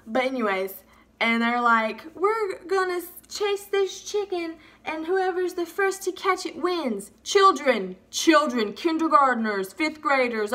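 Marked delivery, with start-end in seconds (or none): 2.1-7.1 lightly voiced, higher announcer; mock announcement of a contest, pitched up; game announcer. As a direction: put on a higher organiser voice. Voicing game organisers announcing the chase.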